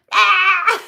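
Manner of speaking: high mock squeal, loud and piercing. To the viewers, mocking [name] on stage, a shrill scream. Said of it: "She's just screaming".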